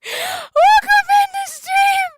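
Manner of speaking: very high, tearful squeal of welcome; crying. Real, not acted. Streamer welcoming a newcomer mid-cry, high and tearful. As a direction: greet them through tears, voice squeezed high. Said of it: "I am sitting here crying right now".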